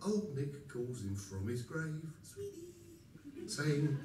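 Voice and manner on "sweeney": much higher, far softer eerie call; ghostly call. Acted. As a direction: call him like a spirit from below. Old Nick calls from the grave.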